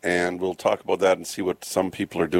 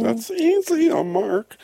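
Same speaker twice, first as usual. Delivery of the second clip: squeaky high falsetto imitating his cohost; mock high voice; much slower than usual. Radio host teasing his cohost with a mock high voice. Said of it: "She's got the higher voice. I have the deeper voice".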